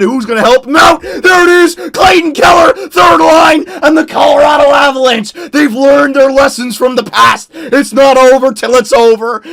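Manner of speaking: loud, high goal-call shouting; way too excited. Real, not acted. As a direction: shout it like a frenzied hockey play-by-play. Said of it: "I really got way too excited".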